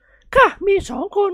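very high, slow falsetto; pleased matron. Acted. Lady telling of her two daughters, pleased falsetto. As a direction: answer sweetly in a woman's voice.

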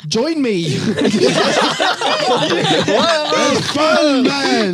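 high, loud laughing hippie plea. Pretend. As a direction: laugh through a mock cult invitation. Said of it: "You sound like a hippie".

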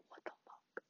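breathless whispered what the fuck; stunned whisper at the teacher's answer; whispered disbelief. Retold or imitated. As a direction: mouth it in shocked whisper. Reacting to teacher.

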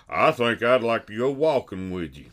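light put-on Berman voice; a hint of Berman's voice; imitating Berman. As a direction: lightly mimic your buddy. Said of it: "And he go".

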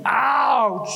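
drawn-out pretend ouch; playful mock pain. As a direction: say ouch on the listeners' behalf. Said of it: "I'll say it for you".

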